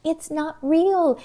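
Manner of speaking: each word of "It's not real" pressed; emphatic. Shown as a demonstration. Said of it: "repeated for emphasis".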